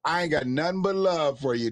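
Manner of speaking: ordinary talk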